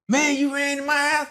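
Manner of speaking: high, stunned you ran in my house; the owner can't believe it; high, astonished question; shocked disbelief. Retold or imitated. Homeowner shocked by confession.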